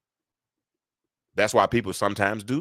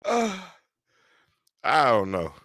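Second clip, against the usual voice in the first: ordinary talk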